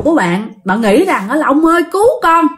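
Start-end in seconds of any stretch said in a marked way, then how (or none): 1.5-2.5 raised, pleading cry for rescue; calling for help. Acted. Frightened sleeper begs the old man to save her, pleading. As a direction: call out to an elder to save you. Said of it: "Tự nhiên bạn kêu vậy đó".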